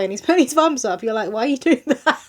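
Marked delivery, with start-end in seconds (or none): 1.3-2.3 high, amused and puzzled; baffled laughing question. Retold or imitated. Mum mocking the dad giving thumbs up, laughing. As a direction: laugh it out in disbelief.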